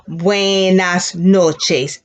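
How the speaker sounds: very slow, every syllable clear; slow classroom demo; slow teacher model. Shown as a demonstration. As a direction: drag it out so kids can follow. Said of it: "And good night".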